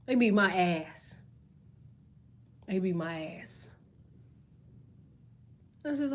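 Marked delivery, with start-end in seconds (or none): 0.1-1.1 drawn out, each word slowed for effect; slow punchline. Retold or imitated. 2.7-3.7 softer, trailing repeat of the punchline; quiet echo. Retold or imitated.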